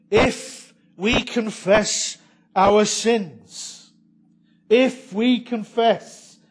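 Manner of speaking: slow, loud, every word stressed; emphatic quote. Acted. Preacher pressing John's condition on the congregation, slow and loud. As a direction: lean hard on if we confess. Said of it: "You see what John says".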